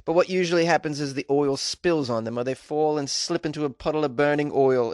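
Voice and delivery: a clumsy put-on Australian accent, slowed; an awful fake Australian accent reading a doctor's quote; bad Australian accent. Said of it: "the worst Australian accent you've ever heard".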